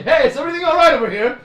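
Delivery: high, loud and fast, calling across the room; loud friendly call. Acted. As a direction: call out over the brawl.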